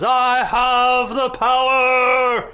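hammed-up transformation cry, drawn out and triumphant; He-Man battle cry. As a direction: bellow it like a cartoon hero holding up his sword. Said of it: "and he says".

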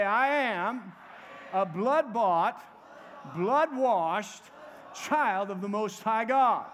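loud, slow rallying confession; rally the congregation with enthusiasm, phrase by phrase; enthusiastic declaration. Shown as a demonstration. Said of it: "say it with a little bit of enthusiasm".

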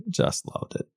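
breathy whispered repeat. Real, not acted. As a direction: whisper it dreamily. Gushing about piano theme, repeated.

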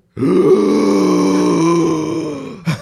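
a huge, loud, high gasp of someone coming back to life; Undertaker gasp. Retold or imitated. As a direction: gasp like a wrestler rising from the dead. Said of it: "that's exactly what he did".

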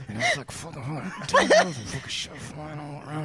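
hushed mimicry, mostly under the breath; quiet intense. Cohost mimicking Steve explaining how hard it was, quiet. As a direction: imitate him muttering the obscenities softly. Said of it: "He gets quiet".

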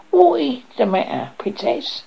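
high, timid little creature voice; timid, scratchy frog. A narrator's reading. Frog to crying princess from behind, timid and scratchy. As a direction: pipe up scratchily as a shy frog. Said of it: "a timid, scratchy voice behind her say".

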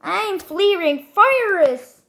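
high-pitched fiery battle line; Amber imitation. Player echoing a fire brawler's boast, high imitation. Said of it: "Jetzt Amber".

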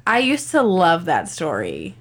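ordinary talk, nothing marked

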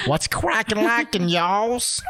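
high, drawn-out mock Southern accent; exaggerated Southern drawl, teasing. Shown as a demonstration. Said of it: "into Southern accent".

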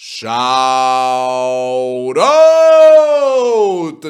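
long-held, very loud holler; hyped shout-out. Real, not acted. Podcast host hailing a loyal commenter, hyped drawn-out shout. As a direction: belt it out, stretching each vowel.

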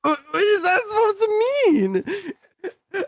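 words broken by crying laughter; laughing. Real, not acted. Cracking up at friend's story, laughing. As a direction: laugh through the whole line.

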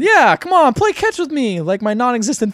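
high, loud whiny begging; whiny robot voice. Retold or imitated. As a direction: sulk like an abandoned kid. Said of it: "he's like".

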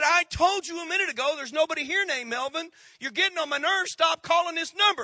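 high, angry telling-off on the phone; anger. Acted. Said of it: "That is anger".

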